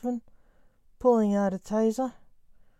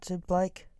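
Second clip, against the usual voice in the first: ordinary delivery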